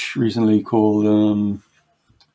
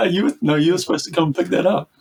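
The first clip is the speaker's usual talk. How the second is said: laughing; 7 semitones higher than usual. Real, not acted. Laughing at a friend's joke.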